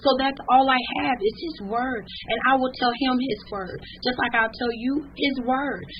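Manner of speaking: high, loud preaching cry; preaching at full force. Acted. Exhorting grieving listeners to tell God their hurt, full-force preaching. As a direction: lift the congregation up, full voice. Crystal preaching on grief, rising.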